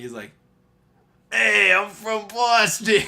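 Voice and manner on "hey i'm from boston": slow, louder, hammed-up Boston fan voice; mock Boston fan. Store guy tells Brady he's from Boston, hammed-up fan voice. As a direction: play the overeager Boston fan, drawn out.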